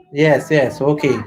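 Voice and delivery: ordinary delivery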